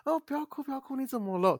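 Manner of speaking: 高八度假装慌张地哄; 学别人手忙脚乱哄小孩; mock flustered comforting. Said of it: "有些人看到哭的时候会很慌张". (模仿慌张哄人的语气)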